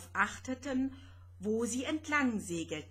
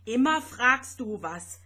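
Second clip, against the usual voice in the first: loud, gruff grumble; grumbling snap; 2 semitones higher, 9 dB louder than usual. Acted. Little spaceship grumbling at his friend, loud gruff snap. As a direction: snap at her, fed up. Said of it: "raunzte Vanya".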